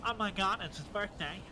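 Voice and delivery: lightly voiced nasal fan, a bit high; attempted nasally voice. Trying to copy a friend's nasally voice. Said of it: "I can't do the nasally voice".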